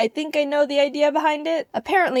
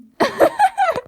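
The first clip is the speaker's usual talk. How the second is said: high shrieking laugh; 8 dB louder than usual. Real, not acted. Laughing at the toy.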